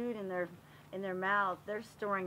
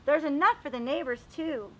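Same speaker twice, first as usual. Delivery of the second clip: lively read-aloud voice, a bit higher; storybook narration; 4 semitones higher, 8 dB louder than usual. Acted. Teacher reading a garden book aloud to the class, storybook voice. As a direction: read it like a picture book for little kids. Reads picture book to children.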